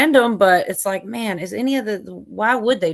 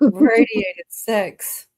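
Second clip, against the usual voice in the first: laughing quote; 3 semitones higher, 6 dB louder, much slower than usual. Retold or imitated. Quoting movie line, laughing.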